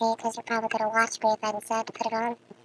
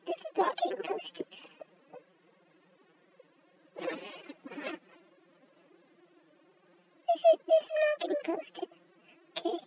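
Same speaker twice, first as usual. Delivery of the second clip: grandma leaving voicemail; 13 semitones higher, 7 dB quieter than usual. Pretend. High quiet put-on voice.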